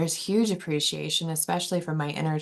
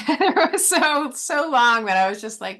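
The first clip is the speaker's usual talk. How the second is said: laughing; 9 semitones higher, 6 dB louder than usual. Real, not acted. Laughing about the long struggle.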